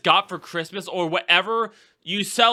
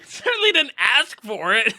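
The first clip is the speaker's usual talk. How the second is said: higher, exaggerated echo of the friend's tone; mocking imitation; 5 semitones higher than usual. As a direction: tease by parroting it back in a put-on voice. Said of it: "but just the tone".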